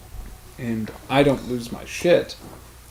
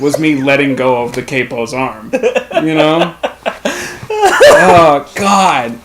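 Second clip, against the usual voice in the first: ordinary talk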